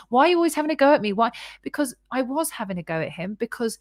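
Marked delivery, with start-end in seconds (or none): 0.1-1.3 defensive whine. Retold or imitated. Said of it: "he'd be like".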